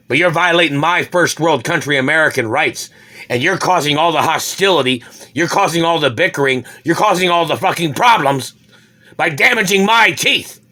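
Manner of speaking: loud, high, furious rant; furious shout. Real, not acted. Ranting at the Germans who hurt him, furious shouting. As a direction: yell the accusations, rising in pitch.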